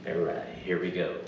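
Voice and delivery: usual speaking voice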